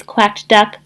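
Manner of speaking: ordinary delivery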